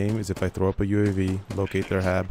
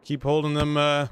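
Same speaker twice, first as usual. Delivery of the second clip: urgent commands; 5 semitones higher than usual. Real, not acted. Commanding squad in live battle.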